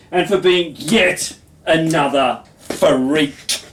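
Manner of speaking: slow, emphatic performance-poet delivery; poetry recital. Acted. Poet performing own poem to an audience, emphatic recital. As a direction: declaim it in drawn-out, punched phrases.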